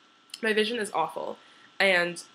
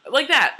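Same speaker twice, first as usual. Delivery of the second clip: high kiss pop; 7 semitones higher, 9 dB louder than usual. Shown as a demonstration. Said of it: "if I had more chapstick on".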